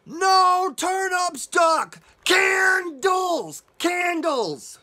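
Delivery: high, loud exasperated shout, drawn out; yelling. Acted. Storyteller voicing Mouse yelling at Duck, exasperated shout. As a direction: yell it word by word. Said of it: "Mouse yells".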